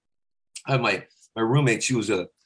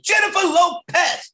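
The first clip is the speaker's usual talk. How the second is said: much higher, slow shouted name; 4 dB louder, slower than usual. Shown as a demonstration. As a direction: coach demonstrating a high shouted name. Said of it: "You gotta say [name]".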